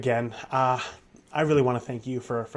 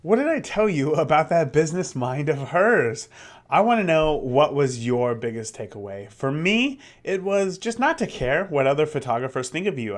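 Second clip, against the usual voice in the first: podcast host voice; 3 semitones higher, 5 dB louder than usual. Acted.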